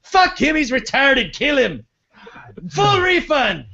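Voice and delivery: high shouting mock tycoon, swinging to syrupy; Don Sterling voice. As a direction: flip between rage and fake love. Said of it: "This is Don Sterling giving this interview".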